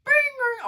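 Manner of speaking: loud, very high-pitched dinging; piercing mock doorbell chime; imitated doorbell. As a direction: mimic a doorbell ringing out. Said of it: "he rang my doorbell".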